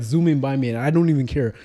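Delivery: normal talking voice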